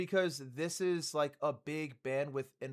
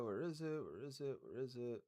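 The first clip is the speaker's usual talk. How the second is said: soft, absent-minded searching mumble; muttering to oneself; 4 semitones lower, 10 dB quieter than usual. Real, not acted. Streamer still scrolling for a comment, soft self-talk. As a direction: say it softly to yourself while looking.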